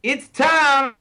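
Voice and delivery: put-on Aussie host voice, announcing; game-show announcer in a mock Aussie accent; podcast host teasing his co-host in a mock Aussie accent; Australian accent. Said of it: "Do you like my accent".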